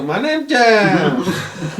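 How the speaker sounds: high, drawn-out goofy holler; dopey catchphrase voice. Acted. Guard salutes out of nowhere, goofy drawn-out holler. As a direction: announce yourself like a proud simpleton. Same catchphrase after smacking him.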